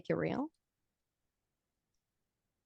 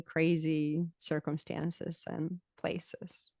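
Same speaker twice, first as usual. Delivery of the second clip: whisper; 7 dB quieter, much slower than usual. Real, not acted. Sudden slow whisper.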